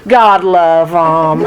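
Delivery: low, slow stock pity; put-on sympathy. Shown as a demonstration. Speaker trying another phrase they never say, put-on sympathy. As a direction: draw out a sympathetic cliché in a low voice. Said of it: "oh, here's another one".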